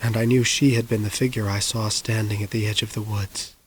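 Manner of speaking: plain reading voice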